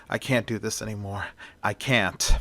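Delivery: a near-whisper of despair after a nightmare; quiet despair. Acted. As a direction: murmur it to yourself, broken. Max alone after a nightmare.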